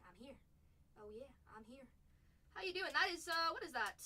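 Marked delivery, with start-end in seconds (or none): 0.0-1.9 soft, low voiced as the player; quiet mock player voice. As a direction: lightly voice the player, hushed. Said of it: "he says".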